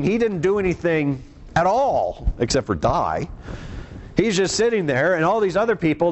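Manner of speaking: ordinary delivery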